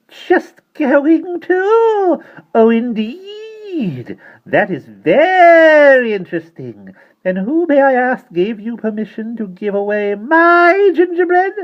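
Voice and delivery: put-on very high, slow, drawn-out fierce old-woman voice; soft fierce menace. A narrator's reading. Tiny mother terrifying her daughter over the gingerbread, slow and fierce. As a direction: menace each word slowly and coldly. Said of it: "in a soft, fierce, terrifying voice".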